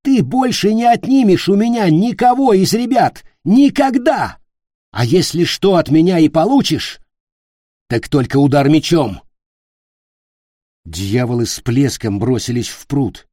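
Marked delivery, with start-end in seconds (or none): 0.0-4.4 high, loud, outraged yell; furious shout. A narrator's reading. Питер refuses to give up any child, shouting. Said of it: "закричал Питер". 4.9-9.2 loud, higher defiant threat; angry threat. A narrator's reading. Питер threatens the captain with his sword, angry. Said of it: "закричал Питер".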